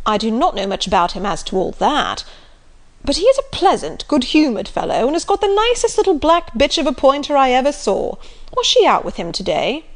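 loud, bluff country squire; jovial loud old gentleman; hearty Sir John. Acted. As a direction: boom it out like a jolly squire.

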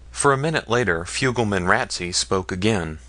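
ordinary delivery

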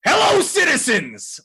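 high, drawn-out mock hailing shout; imitating a friend's shouted catchphrase; imitated signature yell. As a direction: holler it slowly like a hammed-up announcer. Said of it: "Dave just has that on lock".

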